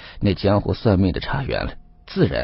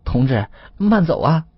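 higher-pitched, sheepish send-off; strained courtesy, pitched up; awkward farewell; 7 semitones higher, 4 dB louder than usual. A narrator's reading. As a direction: call after departing guests with embarrassed politeness. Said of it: "吴老三一脸尴尬地跟在后面".

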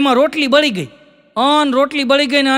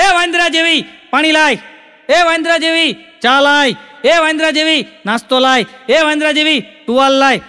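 higher, louder rude barking orders at the wife; rude bossy husband; 4 semitones higher, 3 dB louder than usual. Retold or imitated. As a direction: snap each order at her like a rude husband. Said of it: "તોછડા જ કરે". (પત્નીને તોછડાઈથી હુકમ કરતો પતિ, ઊંચા મોટા અવાજે)

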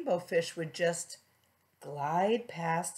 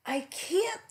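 protesting fish; 11 semitones higher than usual. Acted.

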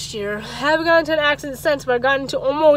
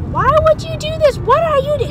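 much higher, louder mock scream; imitating a screaming mother; 6 dB louder than usual. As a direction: shriek it the way the mother did. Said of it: "She was screaming, like".

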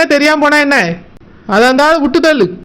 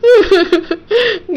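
crying; 3 semitones higher than usual. Acted. Sobbing heroine, lost lover.

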